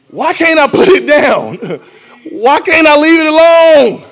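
a man stuck on a Ferris wheel, pleading to God; pleading lament. Acted. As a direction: lament rising high and pleading, slow. Voicing a man stuck in cycles.

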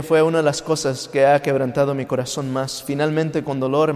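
ordinary talk

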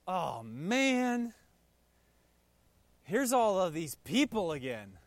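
fed-up sigh of a tired worker; weary groan. Retold or imitated. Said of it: "That would be me. That's how I would respond".

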